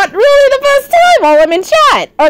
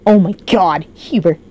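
usual speaking voice

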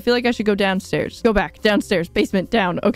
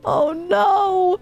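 high panicked shout of alarm; panicked cry; 8 semitones higher than usual. Real, not acted. Gamer panicking at the fire, high panicked cry.

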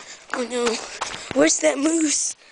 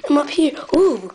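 usual speaking voice

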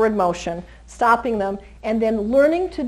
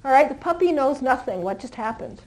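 ordinary talk

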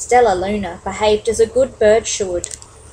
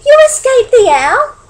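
very high, loud astonished question; squealed astonishment; amazed cry; 10 dB louder than usual. Acted. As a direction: cry it out in joyful disbelief. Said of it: "cried Stella Luna".

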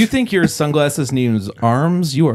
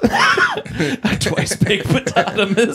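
laughing; 9 semitones higher, much slower than usual. Real, not acted. Laughing repeat of the joke.

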